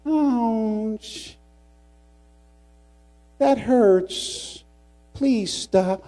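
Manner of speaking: mock a mild, pleading schoolmate; drawn-out, high-pitched polite protest; calm little classmate. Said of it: "looked at me very calmly and he says".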